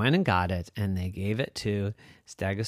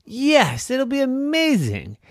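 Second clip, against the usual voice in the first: put-on excited kid dinosaur; 12 semitones higher, 6 dB louder than usual. Excited idea.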